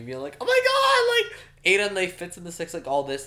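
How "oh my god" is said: high, loud imitated scream; mock hysterical yell, pitched way up. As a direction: shriek it like a yelling crowd. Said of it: "They're all yelling at me".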